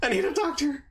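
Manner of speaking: hammed-up wounded whimper; mock pained plea. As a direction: squeak it out like a hurt tough guy. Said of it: "he's like".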